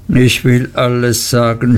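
ordinary delivery, nothing marked

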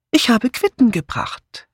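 high-pitched lady's voice; chatty neighbour woman. Acted. Visiting neighbour explaining herself to the sergeant, high chatty voice. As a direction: explain the errand in a lifted voice.

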